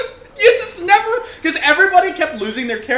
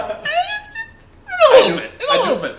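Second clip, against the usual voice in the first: high laughing squeaky mock voice; mock lazy developer. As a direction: parody the lazy devs, pitched way up. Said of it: "Tomorrow goes".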